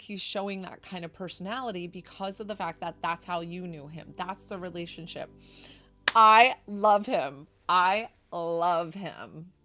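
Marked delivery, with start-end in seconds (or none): 6.1-9.5 loud, slow, gushing I love him; real delighted gush. Real, not acted. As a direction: swoon over him out loud. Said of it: "He is so stinking cute".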